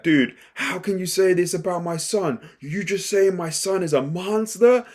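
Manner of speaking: father raging at the doctor over his son; flipping out. Retold or imitated. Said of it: "he just flips out on the doctor".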